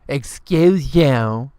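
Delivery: slow, haughty rising "Excuse you"; drawn-out stuck-up put-down; snotty "excuse you". Retold or imitated. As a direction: sneer it like a prissy girl. Repeating the girl's put-down.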